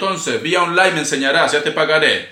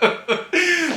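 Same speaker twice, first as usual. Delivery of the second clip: sudden high burst of laughter; real laugh, high-pitched; high giggle; 12 semitones higher, 3 dB quieter than usual. Real, not acted. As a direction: laugh at yourself, high and light. Laughing at himself singing.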